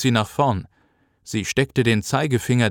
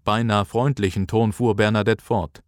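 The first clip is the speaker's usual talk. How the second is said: plain reading voice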